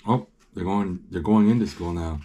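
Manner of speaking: usual speaking voice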